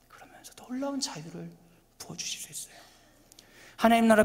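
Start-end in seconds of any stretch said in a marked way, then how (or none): 0.1-2.8 breathy hushed whisper of promise. Real, not acted. Preacher whispering the promise of freedom to the congregation. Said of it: "preacher drops to whisper".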